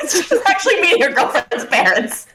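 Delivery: talking through laughter; amused laughing narration. Real, not acted.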